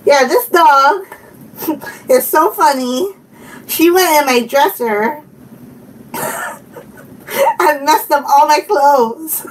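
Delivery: usual speaking voice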